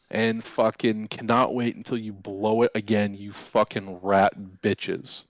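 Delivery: usual speaking voice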